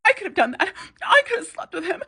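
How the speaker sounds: mock catty comeback. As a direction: toss it off like a smug rival, half laughing. Voicing the rival's catty comeback.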